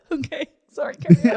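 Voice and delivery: giggling sorry, go on; laughing. Real, not acted. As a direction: still cracking up while apologizing. Apologizing while still laughing.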